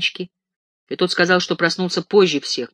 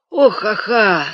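slow, drawn-out, higher yawn of a stretch; sweet lazy stretching groan; 6 semitones higher, 6 dB louder, much slower than usual. Acted. Ganechka waking and stretching sweetly, groaning lazily. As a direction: stretch it out luxuriously. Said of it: "сладко потянулся Ганечка".

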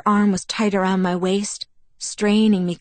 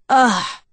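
loud, higher-pitched groan of annoyance; exasperated groan; 6 semitones higher, 6 dB louder than usual. A narrator's reading. Bella gives in to Edward's pressing, groaning. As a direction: groan it out, fed up. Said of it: "I groaned".

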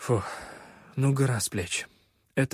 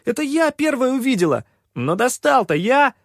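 put-on high, loud kids arguing; loud squabble; 12 semitones higher, 6 dB louder than usual. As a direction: shout over each other, bickering. Kids quarrelling over the find.

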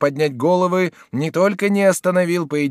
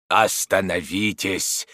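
low, slow, authoritative order; commanding; 6 semitones lower, slower than usual. A narrator's reading. Father separating the fighters, commanding and slow. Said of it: "повторил он властным голосом".

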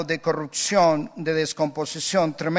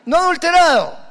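high prim protest, much higher; mock self-righteous churchgoer; 9 semitones higher, 6 dB louder, faster than usual. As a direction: play the pious denier, pitched way up. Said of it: "mocking congregant again". (feligrés santurrón, muy agudo)

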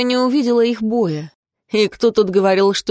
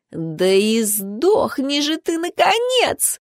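slow, rising to a much higher screeching note; high-pitched shriek; 6 semitones higher, slower than usual. A narrator's reading. Emilia screaming at her rival in the fight, slow high shriek. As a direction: shriek it out, climbing high at the end. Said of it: "Эмилия вопила на высокой ноте".

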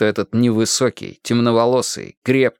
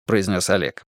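ordinary delivery, nothing marked

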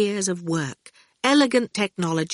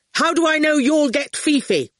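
higher-pitched suspicious challenge; grating voice; 9 semitones higher, 4 dB louder than usual. A narrator's reading. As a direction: demand proof, distrustfully. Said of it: "his voice grated".

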